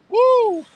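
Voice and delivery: high loud whoop. Real, not acted. Vlogger walking at night cheering, loud whoop. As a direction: let out a joyful woo.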